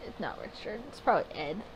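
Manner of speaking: unvoiced whisper to herself; whispered aside. Real, not acted. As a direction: breathe the guess as a secret. Muttered guess to self.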